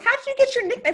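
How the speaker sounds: high-pitched, upbeat re-enacted question; retold question. Retelling her own question.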